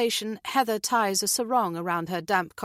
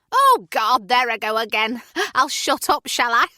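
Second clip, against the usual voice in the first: louder, higher, laughing at herself; self-mocking Julie; 6 semitones higher, 8 dB louder than usual. Julie joking she'll shut up, loud with a laugh. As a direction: laugh off your own chatter.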